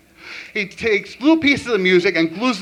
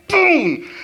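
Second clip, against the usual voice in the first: sudden exclamation; 11 semitones higher, 4 dB louder than usual. Pretend. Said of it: "they all look like this".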